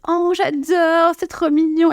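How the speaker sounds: high, slow, cooing delight; gushing local. Retold or imitated. Clerks cooing over the French accent, high and slow. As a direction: coo over how cute it is. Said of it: "Et là, tout de suite".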